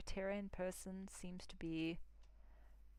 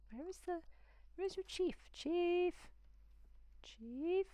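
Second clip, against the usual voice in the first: much higher, mock-posh gushing; hammed-up snooty praise; 11 semitones higher than usual. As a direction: play a snooty visitor admiring the village. Hamming up a snooty visitor.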